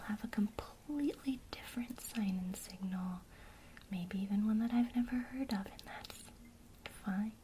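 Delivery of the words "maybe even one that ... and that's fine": ordinary delivery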